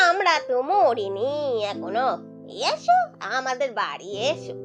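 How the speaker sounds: put-on slow, drawn-out elderly voice; kindly old villager. Village elders inviting their late friend's son home, slow and kindly. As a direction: coax slowly like a fond village elder. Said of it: "বৃদ্ধ লোকেরা বলেছে".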